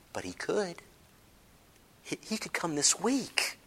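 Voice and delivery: dropped to a near-whisper; quiet, urgent, intimate; hushed. Real, not acted. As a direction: lean in and say it softly.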